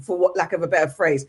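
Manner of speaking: ordinary talk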